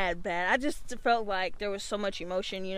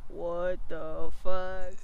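low, loud, very drawn-out disbelief; voicing a roomful of baffled stares; mock disbelief; 4 semitones lower, 6 dB louder, much slower than usual. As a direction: stretch each word out, low and stunned. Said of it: "everybody's face just like".

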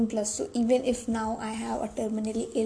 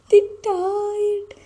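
much higher and louder, mock stunned; shocked gasp; 9 semitones higher, 8 dB louder than usual. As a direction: blurt it like a shocked child watching a film.

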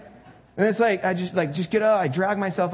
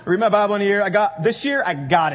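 louder, punchy resolution; bold vow; 2 semitones higher, 7 dB louder, faster than usual. Shown as a demonstration. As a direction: announce it like a sure New Year win. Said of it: "How many of us have made that claim?".

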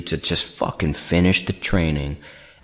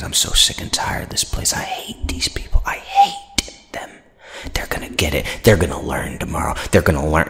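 whispered, hushed imitation of a nerdy guy; mocking nerdy voice; 4 dB quieter than usual. Narrator imitating Kapoor's threats in a whispery nerdy voice. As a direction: mimic a creepy nerd muttering threats. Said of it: "In his stupid fucking voice".

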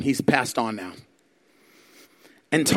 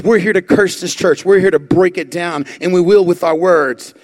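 loud threat; 3 semitones higher, 6 dB louder than usual. Retold or imitated. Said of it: "He says".

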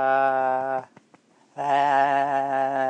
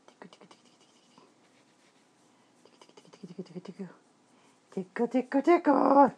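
put-on tickle baby talk; 9 semitones higher, faster than usual.

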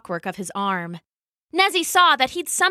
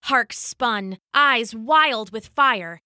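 ordinary delivery

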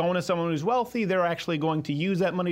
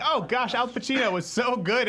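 higher-pitched mock gushing; gushing reaction; 6 semitones higher than usual. Host re-enacting his reaction to watching Scarface, playfully gushing. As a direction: act out an admiring outburst.

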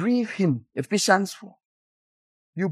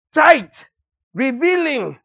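tight, high and loud; loud mock disgust; 6 semitones higher, 4 dB louder, slower than usual. Real, not acted. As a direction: spit out the word tight. Mocking tight clothing.

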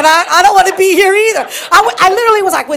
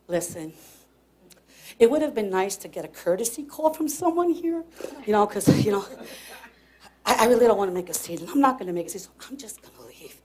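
low, very soft, controlled voice; restrained complaint; 7 semitones lower, 14 dB quieter, faster than usual. Retold or imitated. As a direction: keep it down, holding back a scene. Restrained complaint to clerk, acted.

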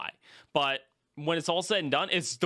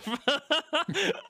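high-pitched giggle; high laugh; 12 semitones higher than usual. Real, not acted. Laughs at own joke.

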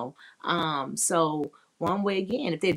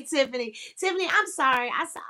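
normal talking voice

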